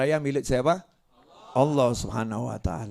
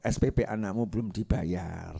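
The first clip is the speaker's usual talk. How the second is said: devil's whisper; 5 semitones lower, 7 dB quieter than usual. Acted. Devil whispering to a wife, low and sly. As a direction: murmur it like a devil in her ear. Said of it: "setan bisi bojo".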